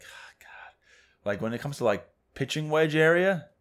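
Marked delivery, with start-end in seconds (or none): 0.0-0.7 whispered groan of dread; a golfer dreading the short game, whispered; despairing whisper. Retold or imitated. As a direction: breathe it out in dread.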